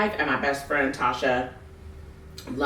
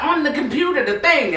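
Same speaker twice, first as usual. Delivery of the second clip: grandma quote; 11 semitones higher, 4 dB louder than usual. Retold or imitated. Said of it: "She was like".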